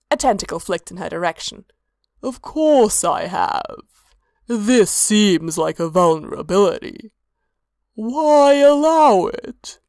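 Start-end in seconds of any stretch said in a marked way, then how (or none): 2.2-9.8 slow otherworldly god voice. A narrator's reading.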